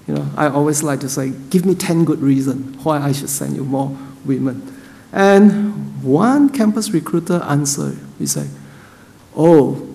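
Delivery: ordinary talk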